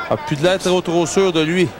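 usual speaking voice

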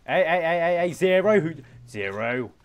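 loud yelling at a pet. Real, not acted. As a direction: shout to stop it. Yelling at a badger stealing.

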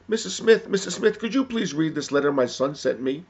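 high, loud call to a neighbor; pleading mother. Acted. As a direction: call out urgently, pitched well up. Calling neighbor for help.

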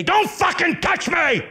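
slow, high furious yell; furious shout. Acted. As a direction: scream him off you. Recoiling from the bitten neck.

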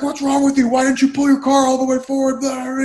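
imitated irate stranger, pitched high; mock whacked-out rant. Stranger berates a driver about parking, imitated whacked-out anger. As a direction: rant in a high voice like someone out of his mind. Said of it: "just totally, like, whacked out of his mind".

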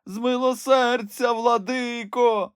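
put-on high, slow old crone begging for mercy; pleading old woman. A narrator's reading. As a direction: beg the bishop, drawn out and high. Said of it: "заблагала стара жінка". (благальний високий старечий голос)

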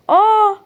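sudden shriek at a monster; startled scream. Real, not acted. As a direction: jump in real fright. A monster appears.

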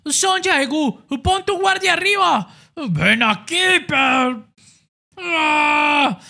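high, loud urgent orders; shouting coach. Acted. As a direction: bark at him to raise his guard. Coach shouting, then a cry.

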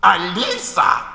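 a high, loud, slow shriek of the name; piercing cry. A narrator's reading. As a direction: call her name out shrill and long. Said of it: "закричал пронзительным голосом".

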